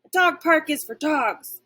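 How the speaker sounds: loud, high barked retort; indignant dog yelling a rule; barking. Acted. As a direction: bark it after the fleeing squirrel. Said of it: "I barked after him".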